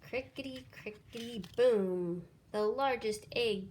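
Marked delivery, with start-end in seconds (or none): 0.0-2.2 crackle then burst; egg cracking. A narrator's reading. Narrator voices the big egg cracking then booming.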